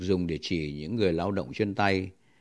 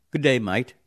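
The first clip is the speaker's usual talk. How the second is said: Australian "Good day, mate." with mate said as "mite", louder; Australian accent; 6 semitones higher, 7 dB louder than usual. Shown as a demonstration. Teacher shows listeners the Aussie greeting, Australian accent. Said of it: "nhưng mà nó đọc đó là the mate".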